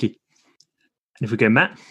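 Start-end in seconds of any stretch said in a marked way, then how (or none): none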